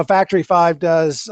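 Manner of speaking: ordinary delivery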